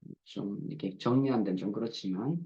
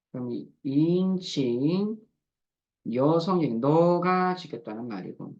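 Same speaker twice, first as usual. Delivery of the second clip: usual speaking voice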